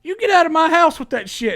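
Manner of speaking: high, loud furious yell; stepdad shouting. Retold or imitated. Stepdad yelling at the armed intruder in his house, loud and high. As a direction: bark him out of the house at full volume. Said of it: "and he said".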